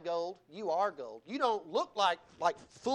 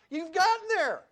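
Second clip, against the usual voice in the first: higher and louder, exultant; triumphant; 7 semitones higher, 6 dB louder than usual. Acted. Pastor picturing reaching the summit, triumphant. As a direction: announce the summit.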